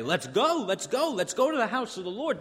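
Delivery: eager, high-pitched rallying cry; excited urging. Acted. Nations urging each other to the Lord's house, excited. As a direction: urge the others on with loud, high energy. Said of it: "They'll say it with excitement".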